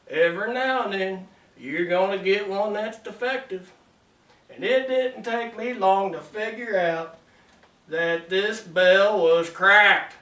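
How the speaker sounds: ordinary talk, nothing marked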